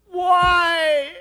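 high, loud, drawn-out wail; hammed-up kid whine; whining little boy. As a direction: mimic a small child's protesting howl. Boy wails back at mother, retold.

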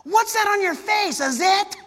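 put-on high, loud, slow child's shout; a blunt kid blurting it out; little kid voice. Kid pointing at the teacher's face, high loud child voice. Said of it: "Matthew, goes".

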